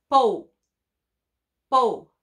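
"pou" popped out loud, a hard p; loud dictation. Shown as a demonstration. Teacher dictates the syllable pou loudly to pupils. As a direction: punch out "pou". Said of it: "syllable dictated loudly".

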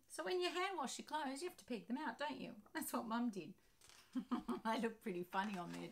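ordinary delivery

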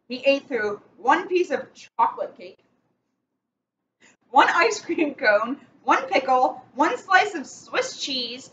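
slow storybook reading with steady rhythm; picture-book read-aloud, measured and clear; reading to children. Acted. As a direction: read it like bedtime story time for kids. Reads picture book to kids.

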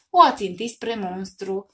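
usual speaking voice

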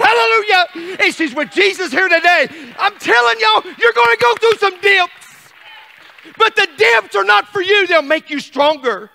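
loud, high-pitched shouted sermon; fired-up revival shouting; full-force preaching. Real, not acted. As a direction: preach it at the top of your voice.

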